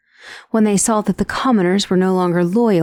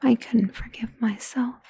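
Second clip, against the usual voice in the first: broken whisper; 3 semitones higher, 6 dB quieter than usual. Acted. Confessing fear for the listener.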